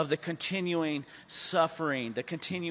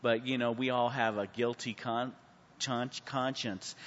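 ordinary talk, nothing marked

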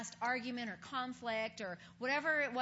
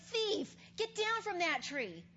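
higher, louder, slow mock rebuke; stern pointing rebuke, hammed up; harsh accusation; 6 semitones higher, 4 dB louder than usual. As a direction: call him out like a thief. The harsh words Jesus avoided.

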